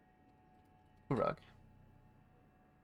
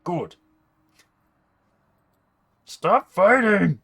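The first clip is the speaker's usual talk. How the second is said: high, loud, slow yell; angry shout; 9 semitones higher, 13 dB louder, slower than usual. Acted. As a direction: yell at them to stop fighting. Servant yells at others.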